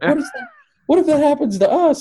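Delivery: put-on high, anxious child voice; scared kids. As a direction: squeak it out like a frightened little kid. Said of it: "They're like".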